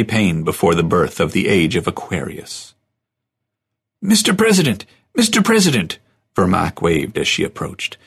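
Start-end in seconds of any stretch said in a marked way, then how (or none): 4.0-4.8 high, bright call across a crowd. Acted. Vermaak calls across crowd. 5.1-6.0 high repeated call across the crowd. Acted. Vermaak calls again.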